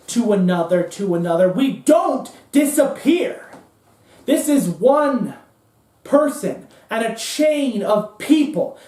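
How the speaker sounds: Cohn. Acted. Cohn warning that the chain never breaks, ominous tirade. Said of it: "He goes".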